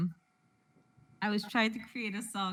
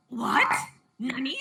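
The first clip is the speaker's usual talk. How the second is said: retold taken aback; 3 semitones higher, 4 dB louder than usual. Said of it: "I was taken aback".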